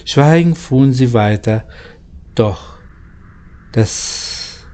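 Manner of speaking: ordinary delivery, nothing marked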